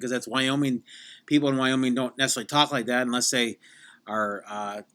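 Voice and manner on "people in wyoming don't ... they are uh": usual speaking voice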